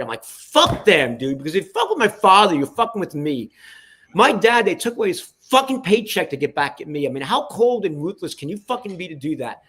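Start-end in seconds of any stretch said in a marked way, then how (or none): 0.2-3.5 loud, rising angry outburst; irate. Retold or imitated. Son enraged over his father's treatment, loud and irate. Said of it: "I was irate". 4.1-9.6 indignant, high and loud at first; hot, worked up. Real, not acted. Son on his father's lost paycheck, genuinely heated and indignant. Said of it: "you're gonna get me hot right now".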